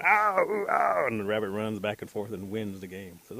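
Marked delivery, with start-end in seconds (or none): 0.0-1.1 high, loud drawn-out mock howls; yelps of pain. Men whacked by the rabbit's sticks, mock pained howls. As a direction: howl like you just got whacked. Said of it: "they're running around going".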